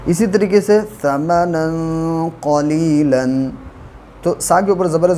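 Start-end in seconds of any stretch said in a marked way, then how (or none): 0.8-3.5 slow tajweed recitation of समनन क़लीलन; Quranic recitation. Shown as a demonstration. Teacher demonstrating tajweed to students, slow recitation. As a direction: recite समनन क़लीलन slowly, nasal hidden noon. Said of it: "ज़बर को जल्दी अदा करें".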